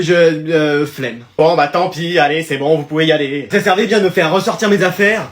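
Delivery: ordinary delivery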